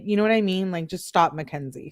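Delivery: ordinary talk, nothing marked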